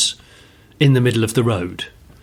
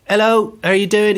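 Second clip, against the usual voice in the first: higher, chirpy imitation of the driving instructor; cheery instructor greeting; 9 semitones higher, 3 dB louder than usual. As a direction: greet the pupil brightly, a light send-up. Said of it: "he went".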